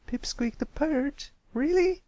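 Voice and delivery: light up with delight; excited disbelief. A narrator's reading. Pipsqueak thrilled to hear his own name, excited. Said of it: "lit up with excitement".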